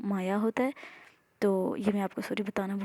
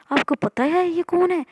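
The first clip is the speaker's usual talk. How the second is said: higher, louder indignant question; indignant complaint; 8 semitones higher, 5 dB louder than usual. Acted. Amara complaining to the aunt, outraged.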